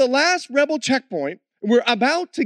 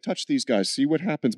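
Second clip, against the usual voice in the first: low, quiet warning from an angel; a hint of a stern guardian angel; 9 semitones lower, 5 dB quieter than usual. Angel on the car roof warning the rebels, low and stern. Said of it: "stood on the top of our car and said".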